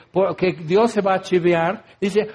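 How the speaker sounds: usual speaking voice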